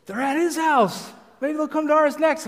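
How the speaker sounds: high, loud mock excitement; excited wife. Acts wife's excited lookout.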